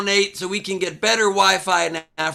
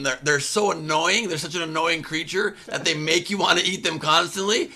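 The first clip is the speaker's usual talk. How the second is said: usual speaking voice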